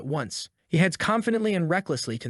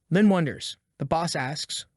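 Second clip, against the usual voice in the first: plain reading voice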